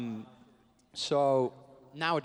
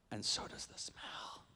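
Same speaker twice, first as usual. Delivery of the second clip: slow, voiceless whisper; hushed and drawn out; whispered punchline; 11 dB quieter, slower than usual. Real, not acted. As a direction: whisper it like an ominous afterthought. Hushed punchline about lingering costume smell.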